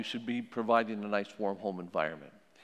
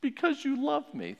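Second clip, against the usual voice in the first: put-on much higher little child voice; child's voice; 3 dB louder than usual. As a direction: answer like a small kid caught out. Child answering parent in role-play.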